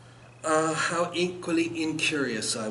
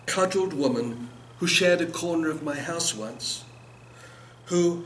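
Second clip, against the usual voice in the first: ordinary delivery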